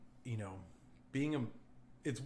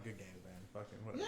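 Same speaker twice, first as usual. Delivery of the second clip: softer, shrugging it off; muttered good games; 6 semitones lower, 12 dB quieter, faster than usual. Retold or imitated. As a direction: toss it away quietly. Acting out shrugging off a loss.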